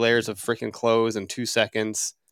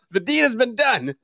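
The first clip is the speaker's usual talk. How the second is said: ordinary talk, nothing marked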